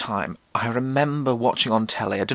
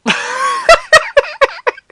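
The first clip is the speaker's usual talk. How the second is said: a loud high squeal of laughter; high shrieking laugh; 10 dB louder than usual. Real, not acted. Remembering the bin bag, squealing with laughter. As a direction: burst into a shrill cackle.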